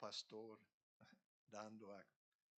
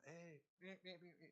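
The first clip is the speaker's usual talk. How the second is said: shepherd's call; 5 semitones higher, 7 dB quieter than usual. Retold or imitated. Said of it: "Y él como el pastor".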